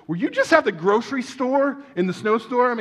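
high, loud, acted incredulous question; urgent questioning. Church friend phoning to question him, acted urgency. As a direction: quiz him urgently, pitch well up.

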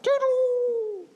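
high, drawn-out mock shooting-up sound; imitated launch noise. Preacher imitating riders slingshotted up, high launch sound. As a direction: mimic being flung skyward.

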